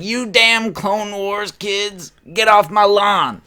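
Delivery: loud, high, slow mock old-man rant; cranky old grandpa bellowing in mock outrage; grumpy grandpa yell. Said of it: "like an old grandpa yelling at a cloud".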